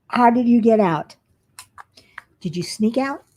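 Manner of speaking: usual speaking voice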